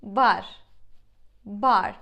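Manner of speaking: crisp, loud Turkish "Bar", no American R. Shown as a demonstration. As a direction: say "Bar" firmly, R light and Turkish. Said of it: "we would pronounce it like that".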